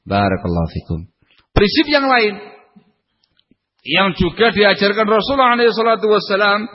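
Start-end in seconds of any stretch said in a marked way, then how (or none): 1.5-6.7 high, pressing sermon delivery; raised preaching voice. Acted. Preacher urging husbands to understand their wives, fervent preaching.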